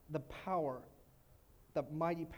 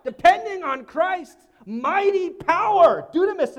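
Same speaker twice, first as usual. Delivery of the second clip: slow, much louder proclamation; preaching at full force; 8 semitones higher, 16 dB louder than usual. Real, not acted. Pastor proclaiming Christ's mighty power loudly. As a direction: stretch it out triumphantly.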